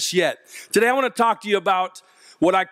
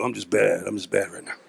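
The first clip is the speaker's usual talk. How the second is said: much lower, softer swaggering "I'm just bad"; tough cool kid; 13 semitones lower, 4 dB quieter than usual. Speaker mocking young men hanging arms out of cars, low swagger. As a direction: play it low and too cool. Boy acting tough in car.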